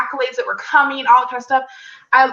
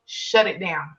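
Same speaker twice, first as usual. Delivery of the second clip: a low, softer, firm Shut it down; calm command; 5 semitones lower, 6 dB quieter, slower than usual. Retold or imitated. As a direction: speak as a calm inner voice. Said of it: "I hear clearly".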